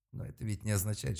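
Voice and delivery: ordinary delivery, nothing marked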